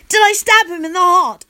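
high, loud excited question; near yell. A narrator's reading. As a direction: practically yell it, pitched right up. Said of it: "I practically yell".

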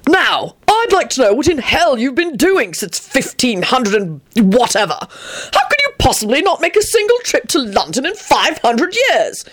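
high, indignant interrogation; thundering. Acted. Arthur takes Antonin's absence personally, high thundering indignation. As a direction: demand answers as if personally affronted. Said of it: "he thundered".

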